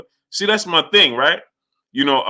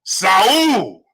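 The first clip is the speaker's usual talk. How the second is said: ring announcer; 2 semitones higher, slower than usual. Shown as a demonstration. Streamer showing how the announcer should have introduced Canelo. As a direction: stretch each title, then belt the name. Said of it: "I would have said like this".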